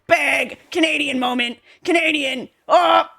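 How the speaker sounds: loud, high mock cheering; mocking commenters. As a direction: ham up excited American commenters. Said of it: "Americans in my comments being like".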